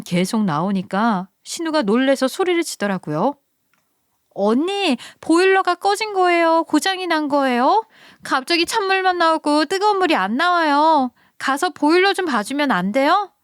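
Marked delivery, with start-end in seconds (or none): 4.4-13.3 put-on high, pleading young woman's voice; sister-in-law calling out from the shower, high and pleading; whiny sister-in-law. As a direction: whine up high from the cold shower.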